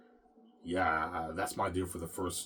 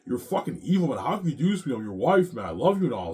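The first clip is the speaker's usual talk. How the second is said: loud, higher-pitched wounded protest; hammed-up pleading rant, louder throughout; imitated yelling; 4 semitones higher, 8 dB louder than usual. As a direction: imitate an upset wife berating her husband. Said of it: "V J starts yelling at me".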